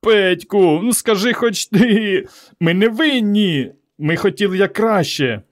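high, slow pleading whine; high-pitched sniveling appeal. A narrator's reading. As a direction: beg your friend tearfully, dragging words. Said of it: "Іван стогнав, рюмсав".

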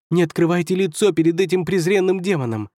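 high, scandalized warning; alarmed protest. Acted. First counselor warning emperor about his face, alarmed.

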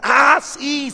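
high, loud burst of remembering; hammed-up moment of recall; sudden recognition. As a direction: exclaim as if it just came back. Acted parishioner suddenly remembers.

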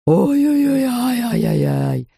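high wail sliding down and slowing; wobbling, drawn-out pained yowl; howling in pain. A narrator's reading. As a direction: yowl a long string of ow-ow-ows. Dentist howling, finger bitten.

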